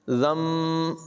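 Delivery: ज़म ending on a humming म, not न; meem not noon. Shown as a demonstration. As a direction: close the lips for म in ज़म. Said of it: "ज़न नहीं पढ़ेंगे बल्कि ज़म". (ज़म, न की जगह मीम और ग़ुन्ना)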